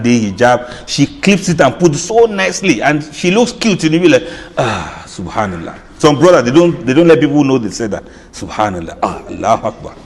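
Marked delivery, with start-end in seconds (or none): 4.6-5.8 hushed awe. Retold or imitated. Admiring a lady in hijab. 8.3-9.9 low, soft awed murmur; hushed awe. Retold or imitated. A brother privately admiring a lady, hushed awe.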